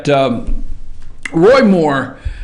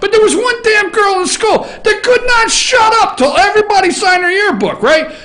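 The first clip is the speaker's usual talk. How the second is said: anguished rant; 12 semitones higher than usual. Real, not acted. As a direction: wail it in mock anguish. Ranting about the yearbook girl.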